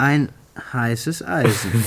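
slow, knowing tease; playful insinuation; teasing. Acted. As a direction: stretch it out to needle him. Teasing, drawn out.

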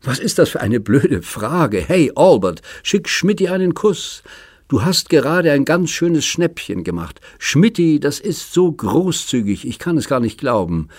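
put-on gushing thanks to her generous old friend; overjoyed squeal. Carrie thanks Schmidt at the table, squealing with joy. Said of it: "Carrie schrie auf vor Freude".

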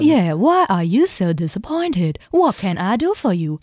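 slow, stiff robot voice. Pretend. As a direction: speak like a humanoid robot. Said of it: "Ich könnte das die ganze Zeit machen".

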